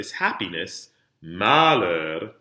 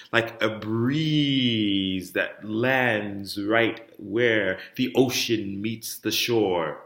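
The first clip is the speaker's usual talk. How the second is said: slow, drawn-out legato; legato phrase; much slower than usual. Shown as a demonstration. Teacher illustrating a legato phrase for singers, slow and flowing. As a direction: glide slowly through to the end, like a breeze. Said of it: "in your legato phrase".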